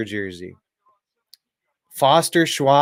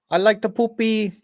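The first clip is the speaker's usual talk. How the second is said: mock Indian accent; 8 semitones higher than usual. Mocking Indian stereotype.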